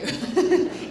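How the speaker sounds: loud, high burst of laughter; real laugh. Real, not acted. Host teasing an old schoolmate, real laugh. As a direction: burst out laughing mid-sentence.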